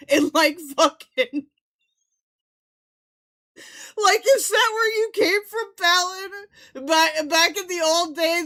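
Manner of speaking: loud, high, laughing outrage; yelling in disbelief. Real, not acted. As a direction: shout it between laughs. Teasing Fallon, yelling, laughing.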